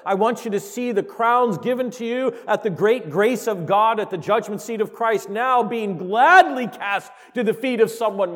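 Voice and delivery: loud, rising sermon build; full-force preaching. Acted. Preacher urging the congregation to picture heaven, fervent preaching. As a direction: preach it with swelling passion.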